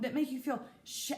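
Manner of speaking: ordinary talk, nothing marked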